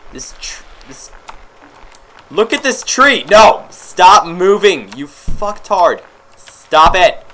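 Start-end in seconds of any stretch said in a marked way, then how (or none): 2.3-7.2 exasperated, loud gamer rant; frustrated yelling. Real, not acted. Player yelling at a game to stop moving, frustrated. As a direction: build to a harsh, loud shout.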